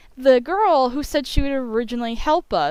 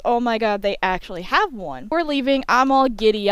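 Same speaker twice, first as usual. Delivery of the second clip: ordinary talk, nothing marked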